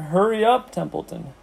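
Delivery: high, pressing plea; urgent urging. A narrator's reading. Pig to rat in no hurry, urging.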